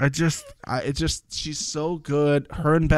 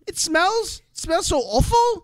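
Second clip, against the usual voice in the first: very high, put-on complaining voice; Chloe. Host retelling contestant's misery on the smelly boat, high-pitched impersonation. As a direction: mimic a disgusted contestant way up high. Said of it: "And she's like".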